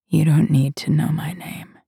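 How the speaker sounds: hushed, enchanting siren whisper; luring spirit whisper. Acted. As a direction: whisper it like a spell into his ear. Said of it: "my siren voice slips from my lips to his ear".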